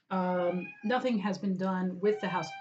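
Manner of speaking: ordinary talk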